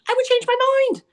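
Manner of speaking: normal talking voice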